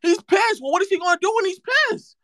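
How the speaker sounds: high, fast shouting hype; mock hype shout. Streamer shouts mock hype at chat, high and fast. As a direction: yell the question up, fast and high, to rile up chat.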